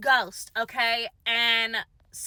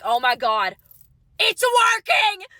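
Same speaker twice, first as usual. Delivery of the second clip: a much higher, louder, slowed shout of triumph; excited shout; 8 semitones higher, 5 dB louder than usual. Retold or imitated. Mother cries out when the summoning works, rising thrilled shout. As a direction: yell it as the ghost finally answers. Mother sees the light appear.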